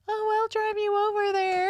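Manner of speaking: very high-pitched imitation of a man's girlish voice; exaggerated high, girly impression; mock girly voice. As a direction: squeak it up high, mocking a date's voice. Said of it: "he just had the girliest voice".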